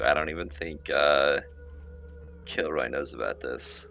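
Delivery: ordinary delivery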